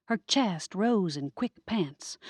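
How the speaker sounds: ordinary delivery, nothing marked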